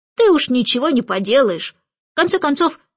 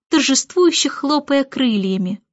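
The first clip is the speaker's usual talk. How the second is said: ordinary delivery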